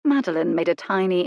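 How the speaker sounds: plain reading voice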